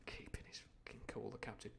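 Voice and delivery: breathy whispered aside. Real, not acted. Reader aside to listeners about the captain, whispered. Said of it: "aside about captain".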